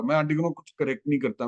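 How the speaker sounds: ordinary delivery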